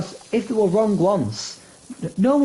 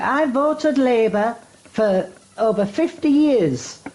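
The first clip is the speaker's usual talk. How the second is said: put-on old lady voter; 6 semitones higher, slower than usual. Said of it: "She's like".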